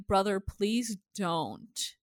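slow, softened retold plea; pleading. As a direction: beg a brother softly, drawing it out. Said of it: "like, pleading".

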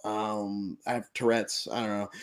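hesitant, fumbling liar; nervous bad liar. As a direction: stammer out a weak excuse. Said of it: "Xander being the worst liar ever".

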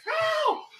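high yelp of sudden pain. Acted. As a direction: yelp like you just got kicked. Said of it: "Jebediah's like".

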